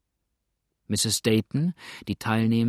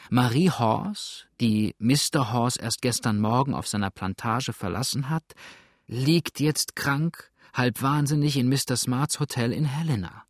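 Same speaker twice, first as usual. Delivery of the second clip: usual speaking voice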